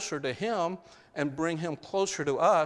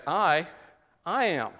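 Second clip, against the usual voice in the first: emphatic "I ... I am" with a deliberate pause; 2 semitones higher than usual. Shown as a demonstration. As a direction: stress "I", pause, then "I am".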